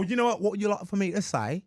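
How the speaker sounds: attempted British accent, 'say' bent towards 'sigh'; American trying a polite British accent. Shown as a demonstration. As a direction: put on an amateur British accent. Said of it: "can you do a British accent?".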